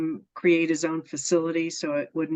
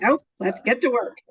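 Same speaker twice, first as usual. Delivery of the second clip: higher, louder, cheerfully bossy friend; brisk no-nonsense reply; 6 semitones higher, 4 dB louder than usual. Retold or imitated. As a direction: snap back brightly, ready to work. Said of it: "She's like".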